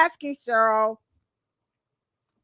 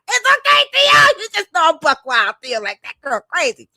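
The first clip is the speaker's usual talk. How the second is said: buck wild reply; 7 semitones higher, 6 dB louder than usual. Pretend. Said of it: "He'll be like".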